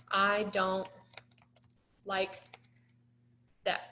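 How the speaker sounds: slow, soft, word by word: I don't like that; slow model script. Shown as a demonstration. Teacher modeling a short script for Sam, slow and soft. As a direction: spell out "I don't like that" with long pauses. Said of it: "I'm gonna retype what you said".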